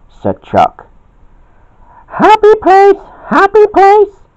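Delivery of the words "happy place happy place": very high, loud squeaky chant; high yell. A narrator's reading. Blossom calls out to calm Bobby, high yell. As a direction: pipe it out at the top of the range. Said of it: "yelled a voice".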